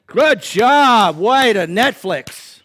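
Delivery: loud mock cheer, stretched out; sarcastic cheer. Mock fan cheering couch sitter.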